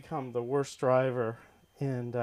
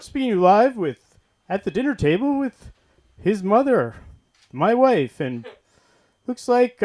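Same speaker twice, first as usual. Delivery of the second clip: loud, higher live-announcer voice; broadcaster dad at dinner; 6 semitones higher, 11 dB louder than usual. Retold or imitated. Father broadcasting the family dinner, loud announcer voice. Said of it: "And then he'd be like".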